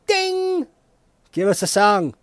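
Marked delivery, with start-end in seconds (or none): 0.1-0.7 high sung ding; mock game show ding; ding chime. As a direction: imitate the correct-answer bell.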